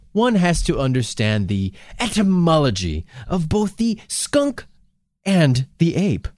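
ordinary talk, nothing marked

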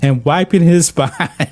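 cracking up mid-sentence; laughing. Real, not acted. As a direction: laugh breaks through the word behind. Breaks into laughter reading.

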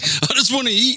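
high-pitched comic whine, rising; mock whining. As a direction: whine it up high like a hungry complainer. Mimics unhappy skinny people wanting food.

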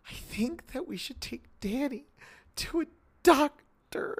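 small high voice, slowly easing the suggestion out; Shelley's pleading line. Retold or imitated. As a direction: imitate a timid wife, high-pitched and halting. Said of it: "The way Shelley delivers this line".

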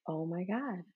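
very soft, stunned aside; hushed realization. Retold or imitated. Mother taking in her son's complaint, hushed realization. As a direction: say it under your breath.

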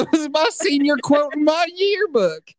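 high, loud comic comeback; mock indignant. Host answering being called an asshole, loud mock indignation. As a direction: fire back with exaggerated indignation.

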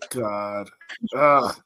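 normal talking voice

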